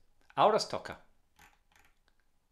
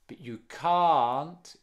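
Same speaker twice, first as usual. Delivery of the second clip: slow and much louder, with "can't" punched; stress on can't; 4 semitones higher, 9 dB louder, much slower than usual. Shown as a demonstration. Teacher showing the stress falls on can't, punched and slow. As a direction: hit "can't" hard. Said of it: "la entonación cae en el can't".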